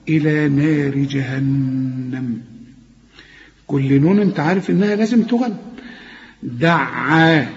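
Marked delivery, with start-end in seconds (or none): 6.4-7.5 very slow دعا, the ع held; نطق دعا بالشدة; doubled ain. Shown as a demonstration. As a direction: lean on the ع in دعا. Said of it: "لو ما فيش عندك شدة هتقول دعا".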